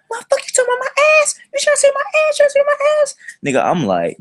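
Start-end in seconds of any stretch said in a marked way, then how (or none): 0.1-3.1 fast, squealing falsetto rant; mock outraged girl. Girl accusing him about her ass, mock outraged. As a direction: fire it off as an imitation of an irate girl.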